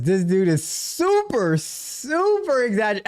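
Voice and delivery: slow, mock-dramatic read with rising pitch; drawn-out emphasis. Host reading viewer's complaint about him, drawn-out mockery. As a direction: stretch each SUPER long and slow.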